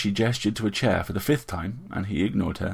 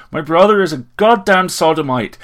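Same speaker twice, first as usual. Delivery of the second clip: loud, high furious outburst; enraged, much louder accusation; furious hiss through teeth; 10 semitones higher, 11 dB louder than usual. A narrator's reading. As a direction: spit it out through clenched teeth, voice raised. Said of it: "he hissed between his teeth".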